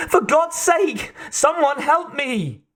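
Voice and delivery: slow, high-pitched desperate plea; crying out. A narrator's reading. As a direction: plead high, drawing out the words. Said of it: "Kate cried".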